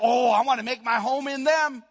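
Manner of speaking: loud, high exclamation quoting God; God's eager declaration. Retold or imitated. God deciding to live in his children, loudly exclaimed. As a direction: cry it out eagerly as a quoted vow. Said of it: "It was basically".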